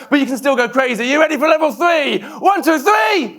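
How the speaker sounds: high, loud rallying shout to fire up the crowd; hype shout. Real, not acted. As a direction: pump the room up, shouting high. Hyping the audience for level three.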